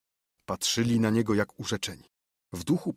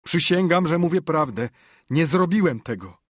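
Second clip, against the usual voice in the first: desperate plea; 7 semitones higher, 6 dB louder than usual. Acted. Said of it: "powiedział płacząc".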